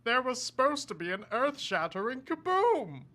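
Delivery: nasal little alien complaint; Marvin the Martian voice. Shown as a demonstration. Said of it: "a more out there voice like".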